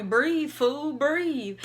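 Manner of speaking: urgent command. Retold or imitated. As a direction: yell at yourself to breathe. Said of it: "my brain is telling my body".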